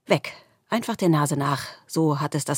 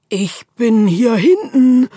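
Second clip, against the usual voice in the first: loud, higher, slow call; 7 semitones higher, 9 dB louder, slower than usual. A narrator's reading. The darkness calls out to the squirrel from far back. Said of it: "ruft die Dunkelheit".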